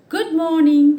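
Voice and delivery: bright, high, loud cheerful good morning; cheerful greeting. A narrator's reading. Teacher reading the hedgehog greeting the ostrich cheerfully. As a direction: greet him with real joy. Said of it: "he said to the ostrich in a cheerful voice".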